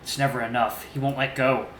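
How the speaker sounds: plain reading voice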